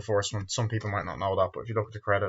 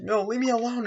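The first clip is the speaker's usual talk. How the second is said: mock crying plea; 13 semitones higher than usual. Retelling Jamie crying out.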